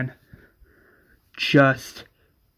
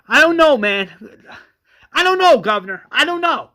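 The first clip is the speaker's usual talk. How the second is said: high, loud panicked repeats; distraught Johnny insisting he doesn't know; frantic denial; 8 semitones higher, 6 dB louder than usual. Acted. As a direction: plead helplessly, voice cracking high.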